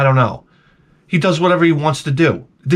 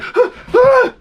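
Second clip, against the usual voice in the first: high laughing fearful gasp; mock frightened gasp; scared gasp; 4 dB louder than usual. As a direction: gasp like you're actually scared. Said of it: "But I'm scared".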